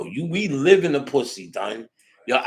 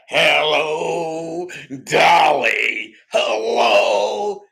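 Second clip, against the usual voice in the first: shouted raspy trumpet-player greeting, pitched up; 4 semitones higher, 8 dB louder, much slower than usual. Retold or imitated.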